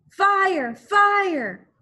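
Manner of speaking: high, loud call of fire; urgent cry through the night; alarm shout. Acted. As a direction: raise the alarm.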